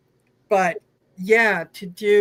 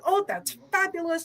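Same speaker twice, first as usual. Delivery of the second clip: higher, swooning retold admiration; retold gushing; 8 semitones higher, 4 dB quieter than usual. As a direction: gush over a lovely find, lightly acted. Retells gushing over a new deck.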